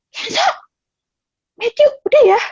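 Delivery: a mother snapping her sons' names to stop them; calling names sharply. Retold or imitated. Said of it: "dengan nada tinggi". (memanggil nama anak dengan nada tinggi dan tegas)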